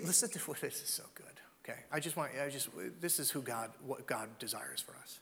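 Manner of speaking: soft whispered aside; whispering. Real, not acted. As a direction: lean in and whisper.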